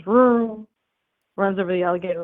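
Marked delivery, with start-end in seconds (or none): 0.0-0.7 imitated car engine roar; loud vroom like a car speeding by. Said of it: "a car comes by and goes".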